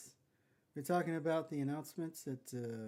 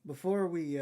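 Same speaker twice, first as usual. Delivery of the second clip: raised voice to take the floor; loud cut-in; 4 semitones higher, 11 dB louder than usual. Real, not acted. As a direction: talk over him, louder. Cutting in over co-host.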